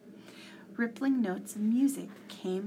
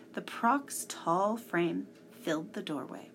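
ordinary delivery, nothing marked

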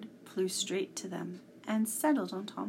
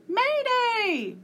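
very high, loud squawking alarm cry; bird shriek; 9 dB louder than usual. A narrator's reading. The wiffle bird shrieking an alarm as it flies up, high and loud. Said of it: "she shrieked".

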